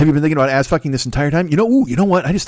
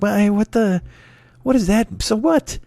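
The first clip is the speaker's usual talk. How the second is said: incredulous; 7 semitones higher, slower than usual. Retold or imitated. Incredulous reaction to Ellen news.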